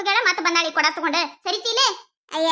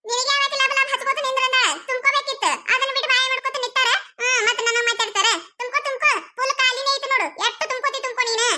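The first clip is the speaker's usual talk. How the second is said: mocking quarrel; 4 semitones higher than usual. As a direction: jeer at her rival over the pot, voice raised high. Quarrel over water pots.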